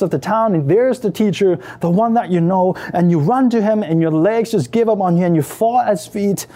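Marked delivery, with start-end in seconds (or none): none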